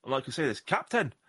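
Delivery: a hammed-up bad Scottish captain warning of whales; terrible Scottish accent. Podcast host jokingly warns the captain in a bad Scottish accent. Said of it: "that was a terrible Scottish accent".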